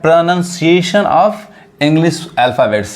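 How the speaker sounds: Pronunciation said "pro-nun-see-ay-shun", very slowly; careful pronunciation. Shown as a demonstration. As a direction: spell it out in sound, very slowly. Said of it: "इसका उच्चारण क्या है?".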